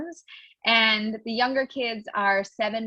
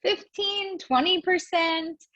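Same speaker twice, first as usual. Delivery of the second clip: son's voice; 9 semitones higher, slower than usual. Retold or imitated. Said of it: "The other one said".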